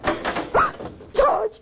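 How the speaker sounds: high startled scream of a name; frightened cry. Acted. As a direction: shriek it in sudden terror. Wife sees burglar attack husband.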